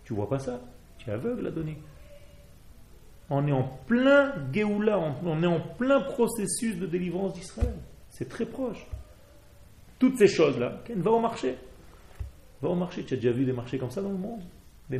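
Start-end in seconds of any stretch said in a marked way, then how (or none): 3.3-11.6 higher, urgent proclaiming; excited proclamation. Real, not acted. Rabbi telling students redemption is near, excited and high. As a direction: announce it like joyful news.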